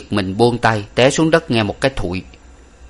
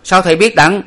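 much higher, louder amazed ask; astonished question; 9 semitones higher, 6 dB louder than usual. A narrator's reading. Onlookers ask the master how he knows, high and loud. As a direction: ask in disbelief. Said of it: "sửng sốt hỏi rằng".

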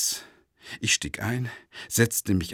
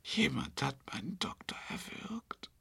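horrified whisper; 5 semitones higher, 11 dB quieter than usual. Acted. Discovers doctor strangled.

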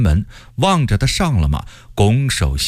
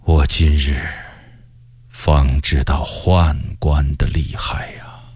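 low, slow, sighing reflection; drawn-out, breath-heavy resignation; rueful realisation; 8 semitones lower than usual. A narrator's reading. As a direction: muse ruefully to yourself. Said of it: "摇摇头说".

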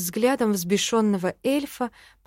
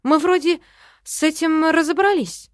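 high, loud protest; exasperated retort, raised in pitch; exclaiming; 7 semitones higher, 5 dB louder than usual. A narrator's reading. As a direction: push back loudly, pitched up. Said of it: "воскликнула я в ответ".

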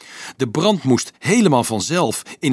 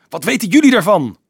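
much higher and louder retort; angry snap; 10 semitones higher, 9 dB louder than usual. A narrator's reading. Dolf turns on the monks who side against him, snapping angrily. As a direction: snap back at them, voice shooting up. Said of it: "snauwde hij".

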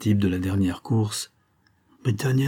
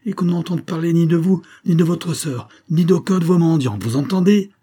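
much higher, louder threat; furious shout; 10 semitones higher, 6 dB louder than usual. A narrator's reading. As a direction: roar the warning at her. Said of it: "se tournant furieux vers la bohémienne".

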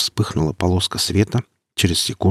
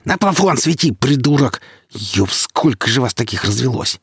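growled; 5 semitones higher than usual. Acted. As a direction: bark the insult in a rage. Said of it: "прорычал".

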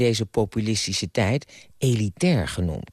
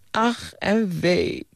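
very drawn-out, high mock-tragic lament; wailing cry; 8 semitones higher, much slower than usual. A narrator's reading. Old man despairing over the lovesick boy, theatrical high wail. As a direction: cry out in slow, hammed-up woe. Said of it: "kreet de oude man".

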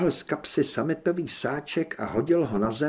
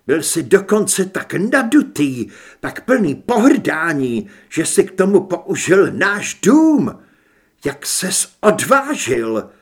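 red-faced rage; 2 semitones higher, 7 dB louder than usual. A narrator's reading. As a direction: lash out at his father with mounting force. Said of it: "Tvář měl rudou a byl celý zadýchaný". (rozčilená, hlasitá tiráda)

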